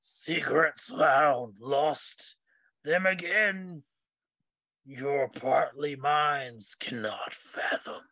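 slow, drawn-out undead intoning; undead corpse. Acted. Game master as hunched corpse to players, slow undead voice. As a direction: intone each phrase slowly, with heavy pauses. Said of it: "and it speaks".

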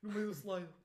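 loud, high, laughing retelling; laughing loudly. Real, not acted. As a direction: tell it through bursts of laughter. Conta o acidente a rir. (a rir alto enquanto conta a queda)

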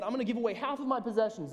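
eager, high, loud and quick offer; Zacchaeus. Retold or imitated. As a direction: blurt it out like a keen volunteer.

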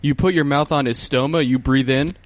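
normal talking voice

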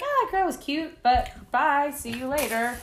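a playful high aside to herself; high mock inner voice. Recalling first seeing her girlfriend at the gym, light high mock voice. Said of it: "And I'm like".

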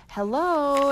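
high bright call into the empty room. Acted. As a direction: call out to see if anyone's there. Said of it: "Annie cried".

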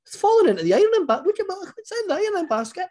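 very high, fast mum voice; squeaky flustered mum, rushing; high hurried mum. Retold or imitated. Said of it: "my mum then goes".

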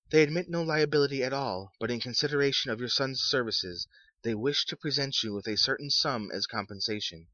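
a hint of a stiff official; formal messenger. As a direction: read it like a polite clerk. Visitor's formal voice.

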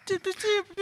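squeaky high doops; high doop sound effect. Acted. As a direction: chirp the doops high and soft. Sound effect after intro.

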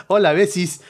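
high, loud, camp little hello; campy greeting. Acted. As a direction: gush it like a flirty diva. Campy fake greeting to listeners.

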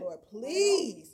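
loud, drawn-out appeal to God; exasperated plea. Acted. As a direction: plead aloud like a worn-out mother. Exhausted nursing mother's plea acted.

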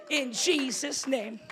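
slow, loud declaration; preacher sealing the line; preaching declaration. Real, not acted. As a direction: proclaim it slowly to the room.

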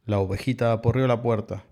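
usual speaking voice

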